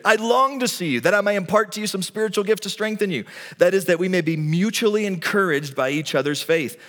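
high, loud, pleading voice of Paul; impassioned preaching. Acted. Paul telling the Roman believers he longs to see them, impassioned. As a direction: pour out longing for the church. Voicing Paul's longing for believers.

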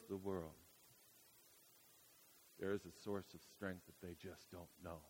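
hushed preaching. Acted. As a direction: lean in and speak it gently, almost to yourself.

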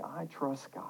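soft, low, matter-of-fact statement; understated. Shown as a demonstration. Preacher giving the congregation a line to repeat, softly. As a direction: say it quietly, without pushing. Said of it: "Say it with a little more confidence".

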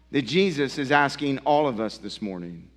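ordinary talk, nothing marked

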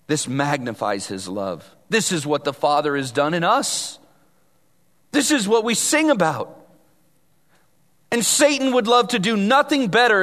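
usual speaking voice

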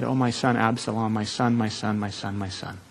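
soft, low, fading to almost nothing; grieving lament. Acted. David mourning his dead son Absalom, softly breaking. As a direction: let the voice sink under the grief. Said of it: "went up to the chamber over the gate and wept".

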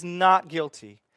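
slow, loud, firm pronouncement; a preacher voicing God as judge, slow and emphatic; judge's verdict. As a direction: declare it like a judge banging the gavel.

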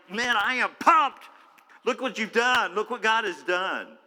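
bursting with excitement; pumped-up Naaman. Acted. Naaman rushing back to the man of God, thrilled and loud.

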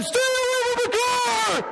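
high, loud barked command; high shouted police order; trooper shout. Retold or imitated. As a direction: yell it like a cop with a gun drawn. Trooper shouting, weapon drawn.

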